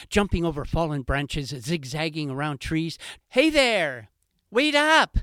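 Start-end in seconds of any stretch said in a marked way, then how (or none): 3.3-5.2 high, loud calls after someone; shouting fox. Acted. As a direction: yell after the heron running, voice high up. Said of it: "shouted the fox".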